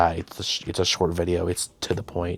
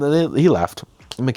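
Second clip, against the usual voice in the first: usual speaking voice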